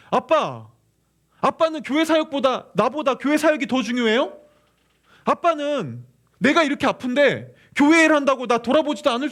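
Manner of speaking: loud, high, pleading complaint to dad; angry child's protest. Retold or imitated. Father voicing what his son should have said, angry. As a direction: voice a child crying out against a father. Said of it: "너 지금 오히려 나한테 화내야지".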